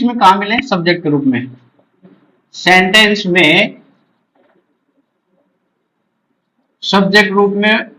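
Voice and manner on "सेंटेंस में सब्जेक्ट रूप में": slow chunks with long pauses; dictating while writing. Real, not acted. Teacher writes out the rule for students, slow dictation. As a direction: dictate phrase by phrase, waiting after each.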